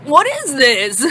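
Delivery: slow, puzzled voice; a lightly voiced baffled boyfriend. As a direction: drag it out, confused by the cake.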